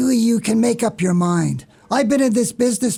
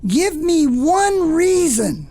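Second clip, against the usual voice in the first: slow, higher, each word drawn out; indignant challenge; 5 semitones higher, much slower than usual. Acted. Boss challenges the clerk who dislikes his box, indignant. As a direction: demand it word by word of a dissenter.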